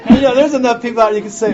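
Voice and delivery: laughing through the words, pitch jumping high; can't stop laughing at the cooler punchline. Real, not acted. Laughs while telling the audience. Laughing at his own story.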